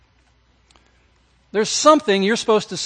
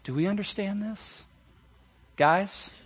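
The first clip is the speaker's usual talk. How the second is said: ordinary delivery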